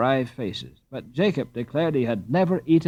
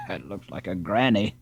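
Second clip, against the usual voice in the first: sleepy disdain; 5 semitones lower, 3 dB quieter than usual. A narrator's reading. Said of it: "in sleepy disdain".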